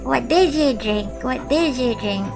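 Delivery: normal talking voice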